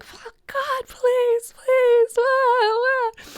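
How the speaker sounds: high-pitched mock wail; hammed-up pleading, crying out to God; dramatic wailing. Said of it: "just wailing".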